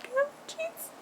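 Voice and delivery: very high, soft mock-tearful squeak; holding back tears. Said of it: "I'm not crying".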